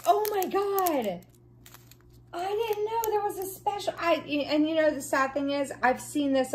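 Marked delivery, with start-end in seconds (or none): 0.1-3.9 thrilled. Real, not acted. Discovers special drill in kit.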